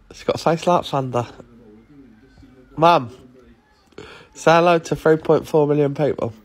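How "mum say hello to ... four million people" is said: loud call across to someone; loud prompting. Real, not acted. Child tells mum to greet millions, calling out. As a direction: urge her loudly.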